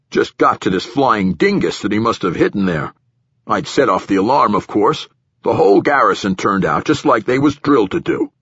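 official giving his account to a superior, a bit pushed; nervous platform director. Acted. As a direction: voice an uneasy director justifying himself.

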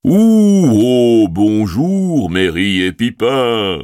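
big booming call, slow at the end. A narrator's reading. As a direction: boom out a hearty greeting. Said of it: "s'écria-t-il en les voyant d'une voix tonitruante".

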